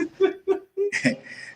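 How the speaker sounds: amused laugh at the idea of working there. Retold or imitated. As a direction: burst of high laughter. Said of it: "I, I just laughed".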